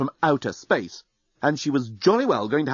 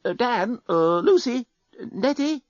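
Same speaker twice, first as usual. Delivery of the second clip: half-hearted calling; 11 semitones higher, slower than usual. A narrator's reading. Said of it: "called half-heartedly out of the car window".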